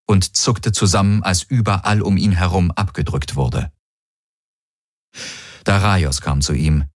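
plain reading voice

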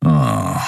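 low, scornful "ah"; dismissive deep huff; contemptuous grunt. A narrator's reading. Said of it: "ein verächtliches".

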